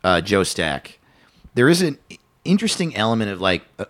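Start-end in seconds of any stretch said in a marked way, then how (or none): none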